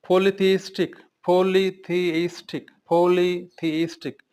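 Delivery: पॉलीथीइस्टिक drawn out slowly, each syllable spelt out; slow teacher repetition of पॉलीथीइस्टिक; slow demonstration. Shown as a demonstration. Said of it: "repeats word slowly for students".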